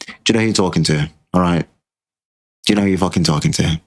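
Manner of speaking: fast, rattled-off challenge; irritated challenge. Real, not acted. Annoyed streamer snapping at chat, fast irritated challenge. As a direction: fire it at them quickly.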